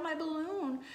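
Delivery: high, loud distressed cry; wail. A narrator's reading. Child watching the balloon float off, wailing. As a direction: plead after the lost balloon. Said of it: "I wail".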